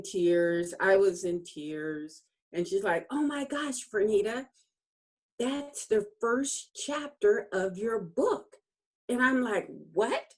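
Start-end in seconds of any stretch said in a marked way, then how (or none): 3.1-8.6 high, delighted imitation of a coach; excited realization. Coach tells her it's the first chapter, excited realization. 9.9-10.3 high, rising one-word question; startled disbelief. Retold or imitated. She hears she has a first chapter, startled disbelief. Acts out her surprise at the news.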